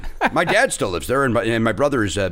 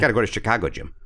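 hammed-up local Chicago accent; 4 semitones lower, faster than usual. Local tells Jim where to go, hammed-up Chicago accent. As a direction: say it like a Chicago native. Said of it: "That's how they say it".

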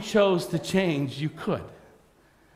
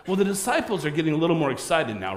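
ordinary talk, nothing marked

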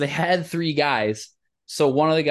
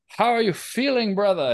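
high, sing-song tree hello; lightly hammed tree welcome; talking tree greeting; 7 semitones higher than usual. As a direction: greet the lost visitors brightly.

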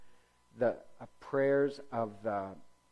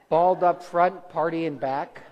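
slow, loud, higher punchline drawn out for laughs; playful punchline; 4 semitones higher, 10 dB louder than usual. Pretend. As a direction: stretch the joke out with a big grin. Joking about monks' haircut.

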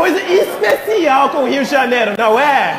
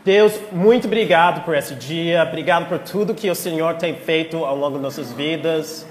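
prayer; 4 semitones lower than usual. Acted. Leads a prayer.

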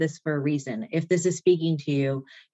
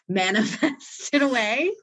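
laughing; 5 semitones higher, slower than usual. Real, not acted. Laughing at the idea.

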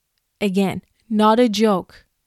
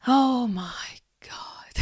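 very slow, half-whispered disbelief; drawn-out whispery exasperation; appalled disbelief; 2 semitones higher, much slower than usual. Real, not acted. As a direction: let it out slowly, almost a whisper, aghast. Appalled at feminists' audacity.

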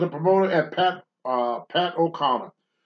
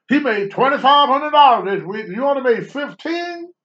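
high and loud, pushing the point; goading disbelief; 9 semitones higher, 6 dB louder than usual. Retold or imitated. Wrestler needling the rookie about his pay in high mock disbelief. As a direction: needle him with fake shock.

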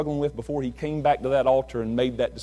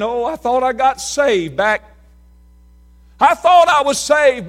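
higher, louder, slow mock wail; imitating weeping convert; 8 semitones higher, 8 dB louder than usual. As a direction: plead it like a tearful sinner at the altar. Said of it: "weeping and bawling and saying".

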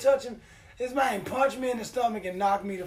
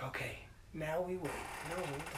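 hushed; 3 semitones lower, 11 dB quieter than usual. Retold or imitated. His character hiding in closet.

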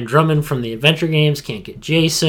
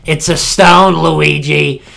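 loud, drawn-out cartoon Mario impression; thick-voiced Mario; 2 semitones higher, 6 dB louder, slower than usual. Podcaster imitating a bad voice coach for Luigi, thick-voiced impression. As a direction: lay the voice on thick, slowing at the end. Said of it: "His voice was so thick in that".

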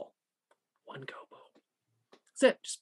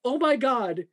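imitated man crying; 7 semitones higher than usual. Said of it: "breaking down crying".

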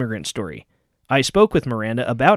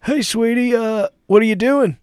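raised, casual husband voice; Bill Clinton; 9 semitones higher than usual. Acted. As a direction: saunter in like a husband checking up.

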